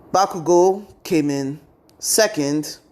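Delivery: plain reading voice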